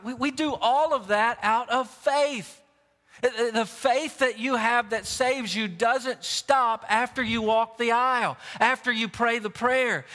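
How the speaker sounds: impassioned preacher, leaning on the key words; emphatic preaching. Real, not acted. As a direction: drive each phrase home to the congregation. Preaches the main point forcefully.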